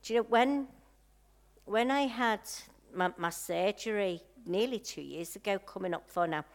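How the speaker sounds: usual speaking voice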